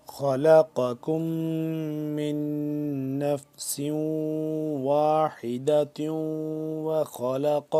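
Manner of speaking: slow, drawn-out chanted tilawat; Quran recitation. Shown as a demonstration. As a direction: recite melodically, stretching every vowel. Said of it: "خا کو موٹا، قاف بھی موٹا".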